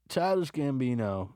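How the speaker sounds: normal talking voice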